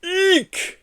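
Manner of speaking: very high squeak of fright; high eek scream. Acted. Santa realising the boy is a Yeti, high squeal. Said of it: "he screamed".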